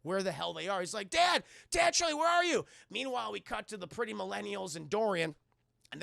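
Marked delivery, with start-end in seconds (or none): 1.1-2.7 high, loud frantic calling; desperate high-pitched shouting. Retold or imitated. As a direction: yell for them across the ship. Searching for dad and dog.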